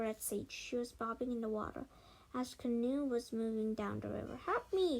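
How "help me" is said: higher and louder pleading cry; hamming up being in danger; cry for help. A narrator's reading. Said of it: "she cried again".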